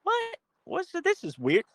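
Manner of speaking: high squeaky mock bewilderment; baffled reaction. Pundit imagines someone baffled by an odd gift, high squeak. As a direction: yelp a confused what, then puzzled. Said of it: "You're gonna be like".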